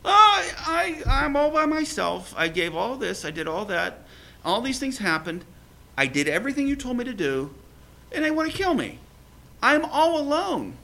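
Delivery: high, self-pitying whine; whiny Elijah. Retold or imitated. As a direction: play Elijah moaning he's all alone. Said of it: "And he goes".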